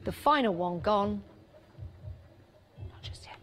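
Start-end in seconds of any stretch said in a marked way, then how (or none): 2.8-3.4 whisper. Acted. Last set nearly sold, teasing.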